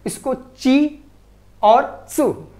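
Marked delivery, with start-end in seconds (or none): 0.3-1.0 ची slow, with a clear ch, not a t; chi sound. Shown as a demonstration. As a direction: stretch out ची with a ch at the start. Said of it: "ता के बाद ती या तू नहीं होता है". ("ची" धीरे, साफ़ च की आवाज़ के साथ)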